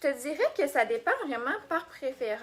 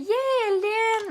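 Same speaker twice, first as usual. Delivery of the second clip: high, loud, drawn-out cheer; excited greeting; 9 semitones higher, 5 dB louder, much slower than usual. Real, not acted. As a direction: whoop a long hello to a favourite aunt. Greets aunt joining the live. (salutation enjouée, aiguë et étirée)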